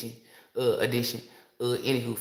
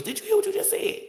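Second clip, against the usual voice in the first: much higher, louder squeal of disbelief; her spirit's voice; 4 dB louder than usual. Retold or imitated. Her spirit shocked at her using the word kneading, squealing. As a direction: shriek it as her own startled inner voice. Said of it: "my spirit jumped out my flesh and said".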